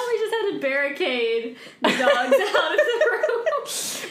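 high breathless laughter; laughing. Real, not acted. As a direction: laugh through the words. Laughing about barricading dogs.

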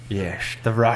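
a hint of a sinister villain; Orochimaru voice. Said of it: "Sound like Orochimaru up in here".

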